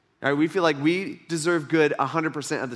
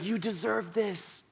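slow, much softer mock-coaxing pitch; soft sales rep; 4 semitones higher, 8 dB quieter, slower than usual. Salesman repeating it over and over, soft mock coaxing. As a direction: coax it like a purring salesman. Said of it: "He's like".

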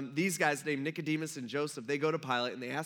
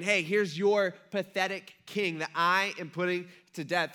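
taunting jab; 4 semitones higher, 6 dB louder than usual. Retold or imitated. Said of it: "one last jab at the religious leaders".

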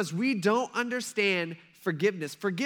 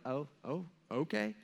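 small, soft, hesitant reaction; imitated awkward "okay", rising at the end; sheepish realization; 2 semitones lower, 8 dB quieter than usual. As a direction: let it dawn on you uneasily. Peter realizing it goes against him.

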